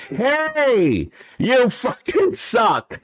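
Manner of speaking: high, slow, drawn-out yell; hollering out the window in Brooklyn; mock Brooklyn window yell. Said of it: "us yelling out the window in Brooklyn".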